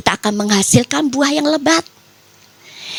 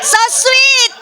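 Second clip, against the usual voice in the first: high-pitched mock-gushing squeal; playful swooning; 10 semitones higher, 3 dB louder than usual. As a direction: gush over a sweet gesture, pitched way up. Playful swoon over husband's gesture.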